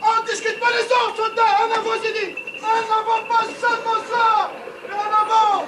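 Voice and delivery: rallying cry in battle. Acted. Officer keeps shouting orders. (ordres hurlés à l'assaut)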